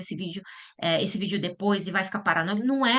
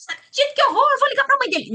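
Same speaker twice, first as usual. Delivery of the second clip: high, loud horrified cry; shocked outburst; 6 dB louder, faster than usual. Retold or imitated. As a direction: gasp it out in horror, high and loud. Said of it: "Não, aí você acaba com o processo". (reação chocada, aguda e alta)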